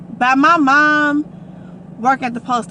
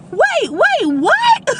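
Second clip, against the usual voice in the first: rising, high and loud what; can't believe what you just read; stunned Wait, wait, what; shocked disbelief; 4 dB louder, much slower than usual. Retold or imitated. Sister's text shocks her.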